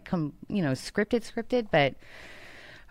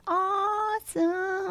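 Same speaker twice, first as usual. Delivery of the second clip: high, drawn-out swoon; gushing awe; 12 semitones higher, 4 dB quieter, much slower than usual. Real, not acted. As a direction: stretch it out high in admiration. Gushing about the concert.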